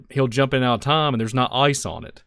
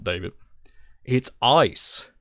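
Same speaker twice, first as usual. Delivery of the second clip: ordinary delivery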